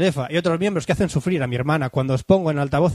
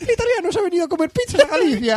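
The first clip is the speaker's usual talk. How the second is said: voz aguda italiana entre risas; acento de Luigi; 10 semitones higher than usual. Pretend. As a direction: sigue imitando a Luigi. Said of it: "acento de Luigi". (acento italiano agudo de broma)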